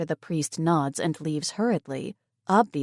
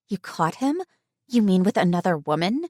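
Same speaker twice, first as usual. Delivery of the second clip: curious friend; 3 dB louder than usual. Acted. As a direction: dig for the gossip. Tegan probes.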